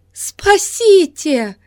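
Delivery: high, drawn-out cry for help; desperate scream. Acted. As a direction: plead for rescue in terror. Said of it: "закричала Исидора".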